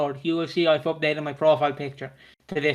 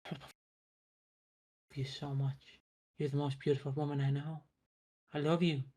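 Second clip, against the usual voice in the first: much softer intimate murmur; soft Theo; 13 dB quieter than usual. Retold or imitated. Theo to his own reflection, soft murmur. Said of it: "Theo is like".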